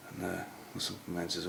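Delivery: usual speaking voice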